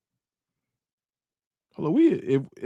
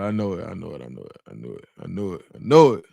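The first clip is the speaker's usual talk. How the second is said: fading repetition; 11 semitones lower than usual. Pretend. Streamer playfully fading out his confidence, echoing. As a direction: let it echo away into nothing. Repeating phrase, fading away.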